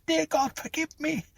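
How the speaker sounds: very high, thin scream, held back in volume; screamed prayer. A narrator's reading. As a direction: beg God in a terrified high cry. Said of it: "she screamed".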